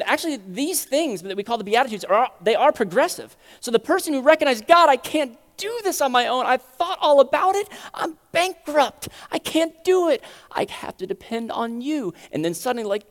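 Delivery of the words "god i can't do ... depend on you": high, mostly loud desperate confession; desperate plea. Acted. Bankrupt believer admitting dependence to God, desperate cry. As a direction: cry out to God, drawing out key words.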